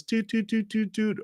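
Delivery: high little train-whistle toots; little choo-choo going toot toot; toy train toots. Acted. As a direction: imitate a children's ride train, sing-song toot-toots. Imitating a little children's train.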